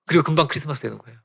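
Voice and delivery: ordinary delivery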